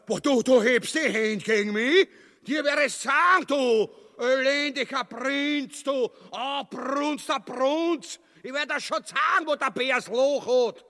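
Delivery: hoch und laut schreiend; tobend. Acted. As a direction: sich in Rage brüllen. Ubu tobt gegen die Polen. (wütend gebrüllt, viel höher und lauter)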